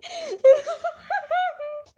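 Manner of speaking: shrieking loud cackle; real laughing fit; loud high laugh. Real, not acted. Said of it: "I shouldn't be laughing that much".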